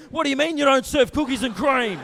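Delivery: loud, high-pitched outraged complaint; mock indignant shout; irate customer. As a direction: yell it like an indignant airport customer. Irate customer in acted tirade.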